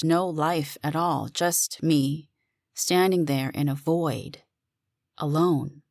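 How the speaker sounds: usual speaking voice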